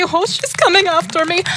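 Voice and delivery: put-on girl hearing the witch behind; mild fright. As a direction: panic a little as she's chased.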